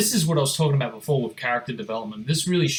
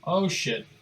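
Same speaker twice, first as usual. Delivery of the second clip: ordinary talk, nothing marked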